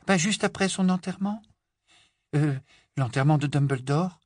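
high, thin, hesitant voice; even tinier voice. A narrator's reading. Hermione admitting when she did it, tiny voice. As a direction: shrink to a meek little squeak. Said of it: "d'une voix encore plus fluette".

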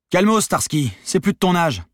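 loud, high, cheeky comeback; unfazed retort. A narrator's reading. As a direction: toss it out undaunted at the gunman. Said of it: "lança sans se démonter".

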